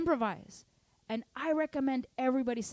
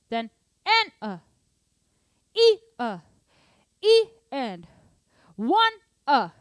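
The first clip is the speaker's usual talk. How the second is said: loud sung "E a", "E and", "one a"; accented counts; 3 semitones higher, 11 dB louder than usual. Shown as a demonstration. Drum teacher singing double accents, loud sung counts. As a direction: belt the accented counts loudly. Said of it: "Then the doubles would be".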